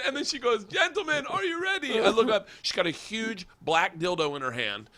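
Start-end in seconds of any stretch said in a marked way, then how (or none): 0.6-2.0 high-pitched woman's announcement; rally the audience as the show's hostess; imitated stage hostess. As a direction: falsetto showtime call. Stage woman announces act, retold.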